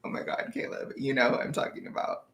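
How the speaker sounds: embarrassed whisper; whispered aside to Caleb, embarrassed. Real, not acted. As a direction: hush it, flustered.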